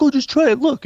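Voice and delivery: put-on high, loud coaxing kid voice; pushy cousin. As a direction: pester her to try it. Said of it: "he's just like".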